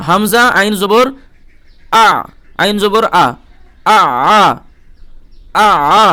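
আ said from the throat, tight and careful; careful ain sound. Shown as a demonstration. Teacher demonstrating a careful throaty আ for learners. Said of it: "খুব সাবধানে উচ্চারণ করবেন".

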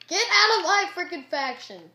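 high, very loud outburst; angry yell. Real, not acted. Gamer to intruders in the faction, angry yell.

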